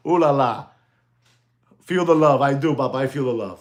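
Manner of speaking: usual speaking voice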